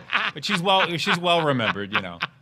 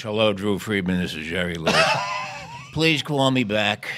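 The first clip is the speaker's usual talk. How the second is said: Jerry Lewis voicemail; 8 semitones lower than usual. Retold or imitated. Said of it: "Jerry calls, leaves a message".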